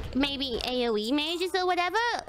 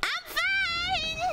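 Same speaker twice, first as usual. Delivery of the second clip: high, drawn-out exclamation; high-pitched exclamation; 9 semitones higher, slower than usual. Real, not acted. Player to the stream after nearly dying, high exclamation. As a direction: insist it in a rising, high cry. Insists she survived, near death.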